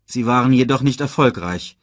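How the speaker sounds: usual speaking voice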